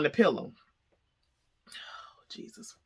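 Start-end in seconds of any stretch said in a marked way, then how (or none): 1.7-2.8 breathy whispered oh Jesus under the breath; whispered dread. Real, not acted. Vlogger recalling the lizard, a whispered shudder. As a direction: whisper it like the memory makes you shudder.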